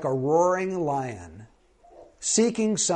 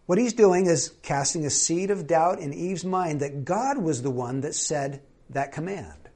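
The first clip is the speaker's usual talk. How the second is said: ordinary talk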